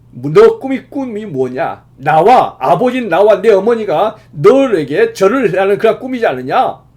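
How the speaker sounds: mock rebuke, pushed up and loud; retold scolding. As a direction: imitate a father telling off his son. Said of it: "이렇게 야단쳤던 것을".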